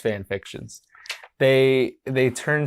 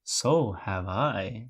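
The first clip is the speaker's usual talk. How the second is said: slow, low, drawn-out character voice; Candy voice; 4 semitones lower, 6 dB quieter, slower than usual. Acted. Candy answering that she has a catch too, slow odd character voice. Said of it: "That's how Candy talks".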